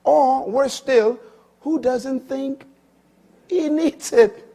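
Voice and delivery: high, loud preacher's climb, slow; preaching. Acted. Preacher warning the congregation, rising and forceful. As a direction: build the warning like a pulpit cry.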